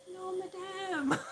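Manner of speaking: very high, drawn-out little call; imitating the delivery girl. Delivery girl waving at her customer, high sing-out greeting. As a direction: trill it slowly, high and small. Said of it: "and she'll be like".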